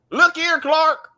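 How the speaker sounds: hammed-up country mama accent. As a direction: holler it high like a country mother. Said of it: "The most country".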